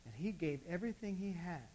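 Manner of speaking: soft altar-call voice to a quiet church; hushed preaching. Real, not acted. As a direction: draw the listeners in close, gently.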